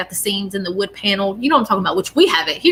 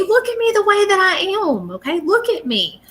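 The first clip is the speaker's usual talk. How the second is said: much higher, pleading voice of the departed; voicing the spirit; 11 semitones higher than usual. Departed man urging his family not to grieve, high and pleading. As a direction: lift way up as if the spirit begs them to look.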